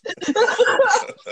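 high laughing burst. Real, not acted. Friend cracking up at the burger story, laughing. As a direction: let a short laugh break out.

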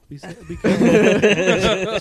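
slow, loud, laughing warning; mock mom caution through laughter. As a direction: mom cracking up as she warns.

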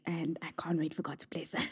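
whispered, half to herself; hushed, hopeful whisper; whispered aside. Real, not acted. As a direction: whisper it like a private wish. Muttered aside about funding.

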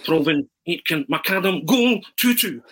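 rising football commentator, peaking high on goal; Arthur Montford commentary. Acted. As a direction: call the scorers, climbing to an excited goal cry. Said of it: "he starts reciting them".

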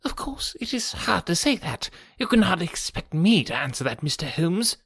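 high, hushed, evasive voice; nervous fashion. A narrator's reading. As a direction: dodge the question in a thin, nervous hush. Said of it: "in a nervous fashion".

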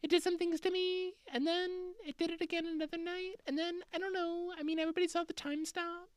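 very high, soft, far-off nervous mumble; a frightened, unsure UFO witness; timid American witness. Retold or imitated. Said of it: "Americans are all just like".

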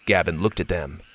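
plain reading voice

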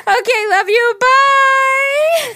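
loud, high squealed farewell; gushing sign-off. Acted. Host reading listener's sign-off, squealing it playfully. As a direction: sing out the goodbye, drawing the last word long.

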